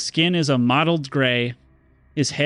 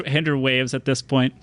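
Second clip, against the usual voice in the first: ordinary talk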